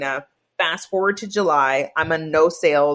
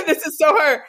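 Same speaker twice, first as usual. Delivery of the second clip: laughing, higher and a little louder; 10 semitones higher, 4 dB louder than usual. Real, not acted. Amused by his client's ways, laughing through it. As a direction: laugh through the words with delight.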